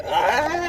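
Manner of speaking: high, drawn-out mock scream; imitated yell. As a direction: imitate her yell with a long rising "Ah!". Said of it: "she yelled at me".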